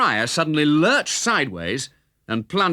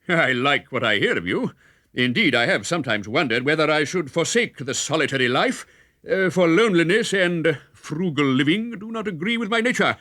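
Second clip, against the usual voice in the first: surprised friar; 5 semitones higher than usual. A narrator's reading. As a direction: marvel at the name.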